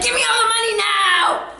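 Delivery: high, loud, wild-eyed demand; mock-unhinged stickup scream; crazed robber shout. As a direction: yell it like a maniac holding up the store. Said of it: "made my eyes go all crazy".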